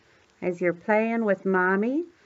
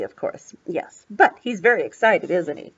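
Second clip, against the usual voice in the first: plain reading voice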